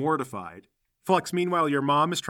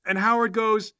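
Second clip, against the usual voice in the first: ordinary talk, nothing marked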